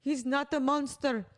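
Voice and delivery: put-on Russian English; bad Russian accent. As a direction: play a movie wife with a hokey Russian accent. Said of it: "this really bad Russian accent".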